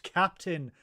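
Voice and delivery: ordinary talk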